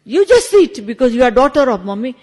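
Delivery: loud, imitated brotherly command; retold firm order. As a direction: act out a brother loudly overruling his sister. Retelling brother ordering her to sit.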